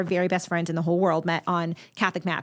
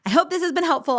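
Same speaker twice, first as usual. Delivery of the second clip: bright and upbeat; 10 semitones higher than usual. Real, not acted. Warm sign-off, repeated phrase.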